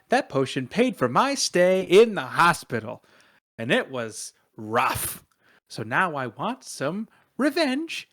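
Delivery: animated, swooping, indignant confession; acted worked-up outburst; aggrieved rant. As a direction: spill the whole grievance, then demand payback.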